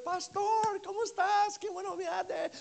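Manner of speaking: high, gushing Spanish welcome; excited Spanish greeting. Retold or imitated. Spanish service members happily greet their visiting pastor. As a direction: greet him warmly in Spanish.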